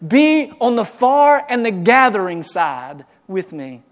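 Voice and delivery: loud, high, impassioned preaching; a preacher building to a roaring climax; preaching at full force. Acted. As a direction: drive it home from the pulpit.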